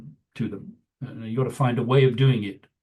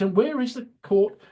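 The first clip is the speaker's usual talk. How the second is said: flustered; 11 semitones higher than usual. Retold or imitated. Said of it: "she got all flustered".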